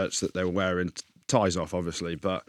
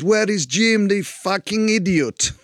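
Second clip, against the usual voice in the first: put-on much higher, slowed French-accented quote; mock French accent; 9 semitones higher, slower than usual. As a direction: play the French coach asking, amused. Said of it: "why we speak like that".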